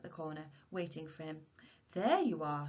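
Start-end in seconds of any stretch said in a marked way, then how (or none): none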